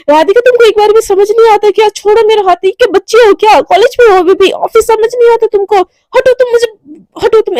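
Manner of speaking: high, loud furious shouting; yelling angrily. Acted. Devika yells at the colleague covering her eyes, furious. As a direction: yell at her to let go. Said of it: "इतनी ज़ोर से वो चिल्लाती है".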